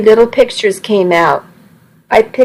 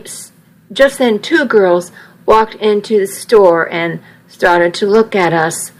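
ordinary delivery

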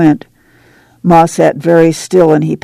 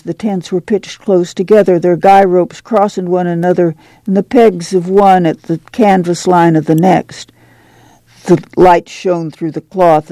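plain reading voice

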